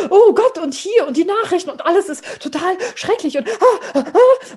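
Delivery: high, loud panicky fuss; hysterical grandmother. Retold or imitated. Grandmother frets over the news, high and frantic. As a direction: flap about the awful news. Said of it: "so eine Hysterikerin".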